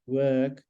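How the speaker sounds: stretched vowel in WORK; long vowel. Shown as a demonstration. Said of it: "it's a long one".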